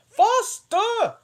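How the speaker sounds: very high, loud cry of the Queen; the Queen screaming to hurry; cried out. A narrator's reading. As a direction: shriek it at full pitch, urging her on. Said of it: "and cried".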